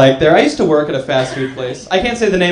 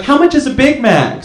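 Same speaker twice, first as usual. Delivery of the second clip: lightly voiced lady customer, pitched higher; customer's question; 7 semitones higher than usual. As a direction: mimic a clueless drive-thru customer. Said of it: "And she's like".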